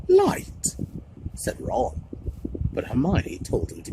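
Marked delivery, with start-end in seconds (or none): 0.1-0.7 jumps very high in disbelief; incredulous. A narrator's reading. Ron disbelieving Hermione's light reading, incredulous. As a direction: echo the word in disbelief.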